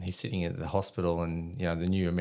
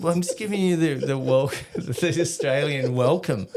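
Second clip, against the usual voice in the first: laughing; 6 semitones higher, 5 dB louder than usual. Retold or imitated. Cheeky explanation while laughing.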